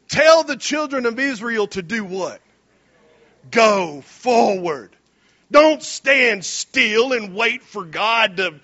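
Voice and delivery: ordinary talk, nothing marked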